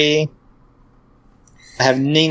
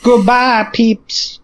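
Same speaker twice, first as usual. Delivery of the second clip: high squeaky goodbye; 8 semitones higher, 3 dB louder, slower than usual. Pretend.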